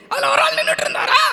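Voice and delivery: high-pitched puppet storyteller; டிங்கன். Pretend. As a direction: tell a tale in a tiny squeaky character voice. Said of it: "ஒரு கதை சொல்லணும்னு". (கதை சொல்லும் கீச்சுப் பொம்மைக் குரல்)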